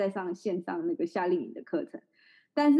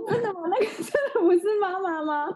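put-on incredulous child; 11 semitones higher than usual. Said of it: "他说".

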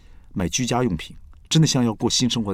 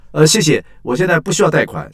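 fake phone brush-off; 8 semitones higher, 9 dB louder than usual. Faking a sales-call brush-off.

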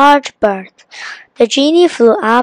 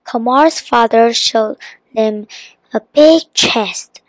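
usual speaking voice

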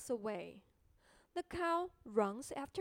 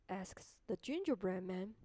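plain reading voice

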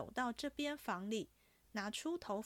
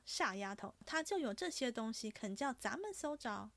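plain reading voice

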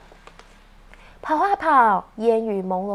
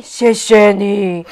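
lower, slow grateful thanks; lower character voice; 4 semitones lower, 9 dB louder, slower than usual. Acted. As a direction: say it lower and slower. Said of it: "互相说".

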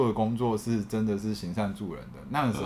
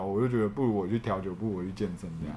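ordinary talk, nothing marked

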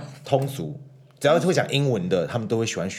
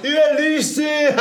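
put-on kid taunt; 7 dB louder than usual. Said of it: "他会这样讲".

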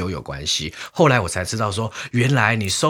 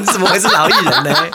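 laughing; 6 dB louder than usual. Real, not acted. Teasing disbelief, laughing.

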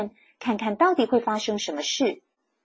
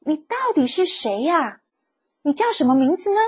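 higher, surprised question; mild surprise, much higher; surprised asking; 7 semitones higher than usual. A narrator's reading. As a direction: ask in wide-eyed astonishment, pitched up. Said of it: "Eliza吃惊地问".

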